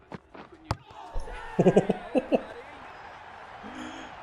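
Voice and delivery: airy laugh that jumps up high; high breathless laugh. Real, not acted. Gamer watching the stumps fly, high laugh.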